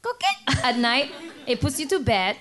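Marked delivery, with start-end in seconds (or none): 0.0-0.6 high chirping frog call. Retold or imitated. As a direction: chirp like a tiny tree frog. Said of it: "It goes".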